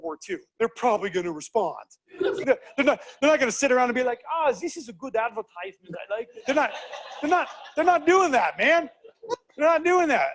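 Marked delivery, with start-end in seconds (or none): 2.2-10.3 laughing. Real, not acted. Laughing disbelief at idea.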